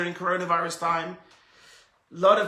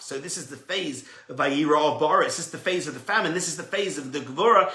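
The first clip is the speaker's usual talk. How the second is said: ordinary talk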